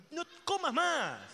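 hammed-up slow scold; drawn-out scold. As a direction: stretch it out like a nagging voice. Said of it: "lo que pasa con el".

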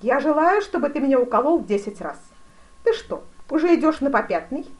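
loud, high imperious demand; великий князь, требовательный. A narrator's reading. (громко и требовательно)

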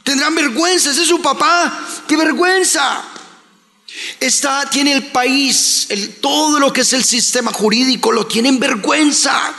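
high, impassioned preacher's cry; preaching at full force. Acted. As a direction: preach it hard, voice climbing. (predicando con fuerza y la voz muy alta)

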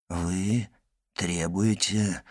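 quiet, low, drawn-out question; hushed, measured challenge; slow incredulous echo. A narrator's reading. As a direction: echo it slowly and softly, pausing between words. Friend taken aback by demand.

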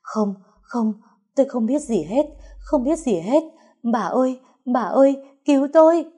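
high-pitched frantic denial; panicked shriek. A narrator's reading. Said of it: "Bà Vú hét lên thất thanh".

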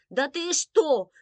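loud, high, drawn-out outrage; incredulous indignant cry; seething indignation. A narrator's reading. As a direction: boil over at mother. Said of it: "Виктоша уже кипела от возмущения".

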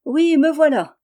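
high, loud, grinning answer; grinning reply. Acted. Rose Air grins at the narrator's door, cheeky grin. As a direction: flash a toothy grin as you say it. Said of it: "en montrant ses dents".

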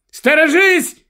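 high, loud cry of alarm; shouted warning. A narrator's reading. Wife warning her husband at the wheel, shouting. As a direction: shout an urgent warning at the driver. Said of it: "кричить Корін".

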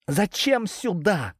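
high, slow call over a howling wind; shouting over wind. Acted. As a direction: raise the voice against the storm. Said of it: "попыталась перекричать завывающий ветер". (перекрикивая ветер)

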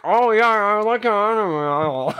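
imitating a chewing animal. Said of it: "You're like".

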